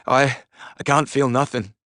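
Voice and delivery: high, slow, halting moan; pained, stammered start, drawn out. A narrator's reading. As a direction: moan it out, hurt and trapped. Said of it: "Finn moaned".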